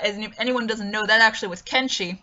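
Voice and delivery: ordinary talk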